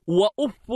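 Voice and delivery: وأف said "wa-uffun", ending in an n; nominative tanwin. Shown as a demonstration. Teacher showing students وأف as "wa-uffun", with tanwin. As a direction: end وأف on "-fun". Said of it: "بالرفع والتنوين".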